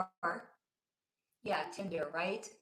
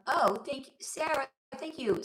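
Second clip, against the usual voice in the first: usual speaking voice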